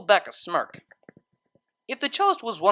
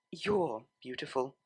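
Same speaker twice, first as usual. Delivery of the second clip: tender; 3 semitones lower, 10 dB quieter than usual. Acted. Tender compliment, touching cheek.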